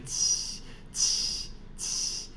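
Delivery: qi breathed without voice; qi whispered as a soft breathy hiss. Shown as a demonstration. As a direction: hiss qi softly. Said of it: "start with the Q".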